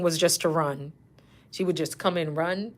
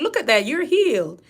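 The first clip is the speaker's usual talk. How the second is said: high, loud feigned wonder; mock miracle; 6 semitones higher, 6 dB louder than usual. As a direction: proclaim a miracle cure, tongue in cheek. Nurse announces patient's fake miracle.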